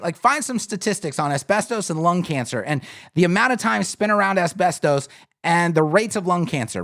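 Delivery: ordinary talk, nothing marked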